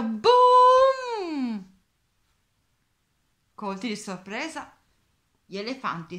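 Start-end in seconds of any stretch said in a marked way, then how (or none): 0.0-1.7 huge high shout at full lungs; shouted boom. Acted. Elmer startling the herd, huge shout. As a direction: blast it out to startle. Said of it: "urlò a perdifiato".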